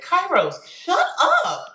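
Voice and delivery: high, sharp mock snap; retold snapping at son. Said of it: "because I was hormonal and not in a good place".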